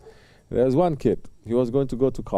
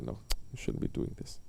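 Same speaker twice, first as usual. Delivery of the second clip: breathless whisper, much softer and lower; inner voice whisper; 11 semitones lower, 14 dB quieter than usual. Retold or imitated. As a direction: whisper it like a conscience inside you. Inner conscience voice speaking.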